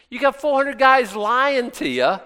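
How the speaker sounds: usual speaking voice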